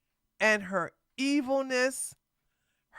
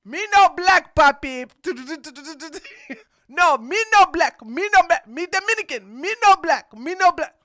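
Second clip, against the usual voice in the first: louder and higher, hammed-up broken English; mock broken-English accent; 6 semitones higher, 6 dB louder than usual. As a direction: ham up a clumsy accent for a laugh. Mocking boyfriend's accent again.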